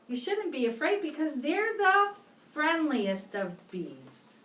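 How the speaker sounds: ordinary delivery, nothing marked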